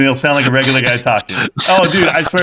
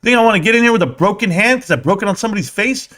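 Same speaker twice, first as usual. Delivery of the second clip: heated; 9 semitones higher, faster than usual. Real, not acted. Heated rant about having much to lose.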